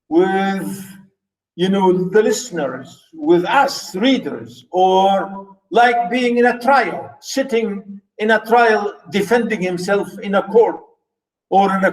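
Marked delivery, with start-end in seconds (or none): none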